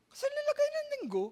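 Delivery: very high mock voice; hammed-up high-pitched imitation; squeaky high falsetto. Retelling Ate Nicole.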